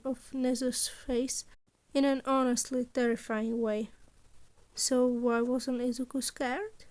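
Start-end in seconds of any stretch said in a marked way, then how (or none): none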